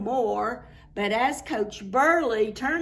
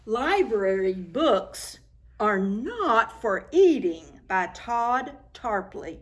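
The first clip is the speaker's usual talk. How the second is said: usual speaking voice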